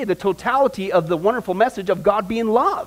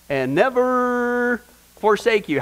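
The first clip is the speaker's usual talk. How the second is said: slow, higher, drawn-out NEVER; emphatic promise; 5 semitones higher, much slower than usual. Retold or imitated. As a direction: stretch "never" out long and slow.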